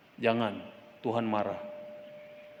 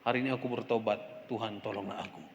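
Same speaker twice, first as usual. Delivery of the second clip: doa lirih; 4 semitones lower, 8 dB quieter than usual. Real, not acted. Doa pertobatan yang lirih.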